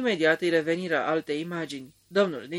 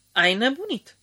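higher, louder incredulous outburst; outraged retort; 7 semitones higher, 5 dB louder than usual. A narrator's reading. Dinsmed to Mortimer, a louder, higher outraged outburst. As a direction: fling it at him as if he's mad. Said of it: "făcu Dinsmed".

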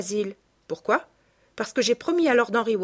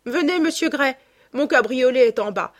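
louder, higher, lightly acted call; brisk hearty summons; 5 semitones higher, 6 dB louder than usual. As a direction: call your companion along and toss out a goodbye. Henry hurries companion off, calls farewell.

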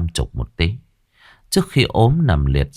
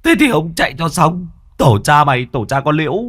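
cursing back; 9 semitones higher than usual. A narrator's reading. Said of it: "nép sau lưng của thằng Đạo mà chửi".

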